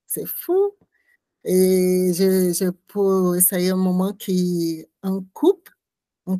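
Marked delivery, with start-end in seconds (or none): 0.1-0.7 amazed. Real, not acted. Retelling a shocking scene.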